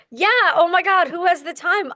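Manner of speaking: high, loud gushing agreement; mock enthusiasm. Newcomer eagerly echoing a veteran narrator, gushing.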